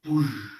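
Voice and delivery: rushing vocal whoosh; storyteller's whoosh sound effect; imitated whoosh. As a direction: make the sound of light bursting out. Imitates the light bursting out.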